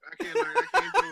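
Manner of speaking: high, loud, fast words through laughter; helpless laughter. Real, not acted. Roaster unable to go on, helplessly laughing. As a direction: give up, overcome by laughing.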